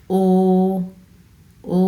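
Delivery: ও held with both lips rounded; rounded vowel. Shown as a demonstration. Teacher showing learners how to round the lips, rounded vowel. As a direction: round the lips fully and sustain ও. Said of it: "গোল করতে হবে".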